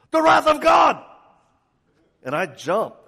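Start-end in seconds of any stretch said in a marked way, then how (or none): 0.1-1.0 much higher, much louder sudden scream. Retold or imitated. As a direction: shatter the silence with a cry. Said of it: "he screams out".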